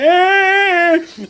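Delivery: grumpy geezer grunt; old man voice. Acted. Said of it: "some old guy there like".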